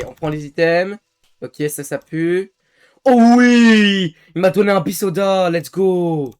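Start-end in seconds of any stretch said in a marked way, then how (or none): 3.1-6.3 excited cheer. Real, not acted. Got an item.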